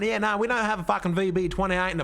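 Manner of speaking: broad ocker Australian accent, lightly put on. Mocking a mate in a broad Australian accent. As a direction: play up the Australian accent, swearing. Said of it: "Australian voice actor".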